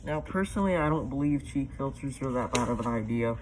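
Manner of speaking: muffled voice inside a mask; through a gas mask. Real, not acted. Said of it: "show you guys what it's like with me in it".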